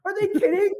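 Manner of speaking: high, laughing mock outrage at the trail; laughing dad impression. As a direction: giggle through the dad's cursing. Dad cursing on the ride, retold.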